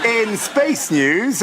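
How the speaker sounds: slow, drawn-out show announcement; savoured, elongated segment intro; relishing it. Acted. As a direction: stretch out each word with relish. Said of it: "I love saying this".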